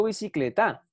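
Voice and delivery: "bicicleta" with the stress on the last syllable, bicicleTÁ; misplaced final stress. Shown as a demonstration. Teacher shows what one doesn't say, "bicicleta" stressed on TA. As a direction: wrongly punch the final TA of "bicicleta". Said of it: "yo no digo bicicleta".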